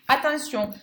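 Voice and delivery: usual speaking voice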